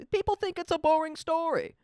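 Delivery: lightly voiced president; mock president quote. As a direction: parrot the president's line mockingly. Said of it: "they're like".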